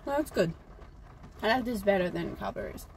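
talking between bites; chewing while talking. Real, not acted. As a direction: mouth partly full. Seen 2: Teal-shirt speaker holds bitten bar, mouth working, thumbs up while talking.

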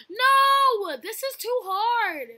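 high, loud, drawn-out complaint; frustrated wail. Real, not acted. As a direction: wail at the unfair game. Frustrated by hard level.

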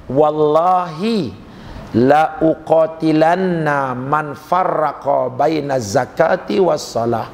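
slow, drawn-out Arabic recitation. Acted. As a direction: recite the Arabic slowly and solemnly.

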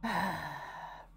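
long, resigned exhale; heavy sigh. Real, not acted. Player hearing the monster takes females, heavy sigh. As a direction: let out a weary sigh. Reacts to monster's mating habits.

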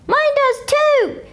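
put-on high, slow kid voice; squeaky-high little boy; eager Herbert. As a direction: chime in eagerly. Herbert speaks.

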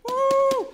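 very high, loud whooping cheer; crowd cheer. Retold or imitated. Preacher imitating the circus crowd's whooping cheer.

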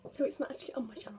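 hushed whisper. Real, not acted. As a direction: whisper so the caller won't hear. Whispers while friend on call.

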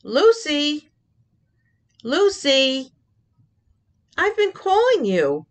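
mother calling her daughter to come, rising calls. Acted. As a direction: call her name across the house. Mom calling daughter from afar.

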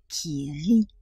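"qui rit" said low and measured; low, teasing setup. Acted. Reader introducing a book that laughs, low and held back. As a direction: drop the pitch on "qui rit".

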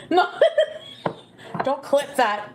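laughing no, then slow laughing plea; laughing through the words. Real, not acted. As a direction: giggle it off, embarrassed.

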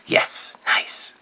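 breathy whispered aside to self; whispered yes. Real, not acted. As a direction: whisper it under your breath. Quiet satisfied aside.

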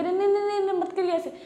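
high frantic pleading; panicked protest. Acted. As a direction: stop her in a flustered panic. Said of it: "मना करने लग जाती है".

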